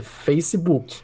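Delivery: Facebook as "feicibúqui", with added vowels; Brazilian-accented Facebook; Brazilian pronunciation. Retold or imitated. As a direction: correct it into Brazilian Portuguese Facebook. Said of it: "Não, fale direito".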